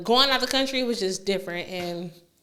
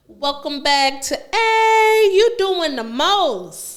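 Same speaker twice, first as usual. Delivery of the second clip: slow, drawn-out host intro; show intro; 6 semitones higher, 5 dB louder, much slower than usual. Acted. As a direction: announce the show name slowly.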